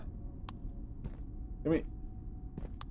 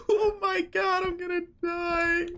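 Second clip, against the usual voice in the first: high, frightened cry; panicked, pitched way up; scared cry; 12 semitones higher, 4 dB quieter, slower than usual. Real, not acted. Scared by what he sees outside, crying out high.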